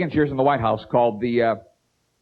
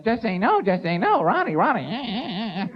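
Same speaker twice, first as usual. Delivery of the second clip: annoying whiny voice, pitched up; Nancy Reagan; 6 semitones higher, slower than usual. Acted. First lady nags her husband, annoying and whiny. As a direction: whine it, dragging out the final words. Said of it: "that annoying whiny voice".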